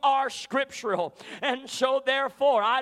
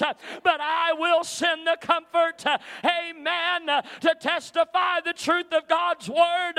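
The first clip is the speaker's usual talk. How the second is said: preaching at full force; 3 semitones higher than usual. Acted. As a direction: whip the congregation up, rising to a shout.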